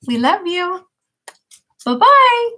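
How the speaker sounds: usual speaking voice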